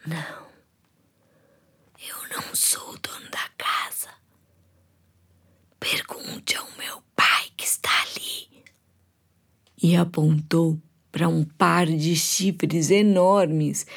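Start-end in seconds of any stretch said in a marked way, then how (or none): 0.1-8.6 sussurro fraco e arrastado; com muita dificuldade. Acted. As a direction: quase sem voz, com esforço. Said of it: "depois de uma longa pausa e com muita dificuldade". (sussurro de velhíssimo cansado)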